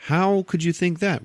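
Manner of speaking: high-pitched acted disbelief; mock incredulous. Quill answering Drax about his dad, high mock disbelief. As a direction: pitch it up in baffled protest.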